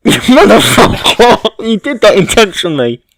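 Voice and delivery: loud, laughing outrage; mock outrage. Real, not acted. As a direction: yell it in fake fury. Partner ruins the clap bit.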